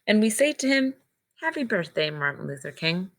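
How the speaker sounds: clear, measured read-aloud storybook voice; reading to children. Acted. Teacher reads picture book aloud.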